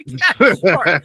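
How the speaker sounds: amused agreement to animators' photo request; laughing agreement. Retold or imitated. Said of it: "I'm like".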